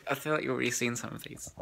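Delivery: normal talking voice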